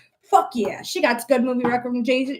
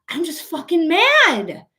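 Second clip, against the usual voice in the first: real anger; 6 semitones higher, slower than usual. Real, not acted. Telling livestream viewers she is just furious, raised and heated. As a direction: let the real frustration boil over, slowed. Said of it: "I know I'm being very aggressive".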